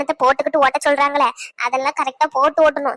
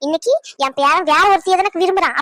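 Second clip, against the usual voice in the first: normal talking voice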